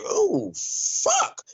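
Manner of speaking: sudden high-pitched "Oh, fuck" of dread; mock panic. Fighter realizing his opponent won't break, mock high panic. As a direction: play the fighter realizing he's in trouble. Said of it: "he was like".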